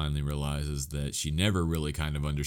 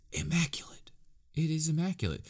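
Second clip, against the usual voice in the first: whisper; 10 semitones higher, 4 dB quieter than usual. Real, not acted. Awed praise of the ending.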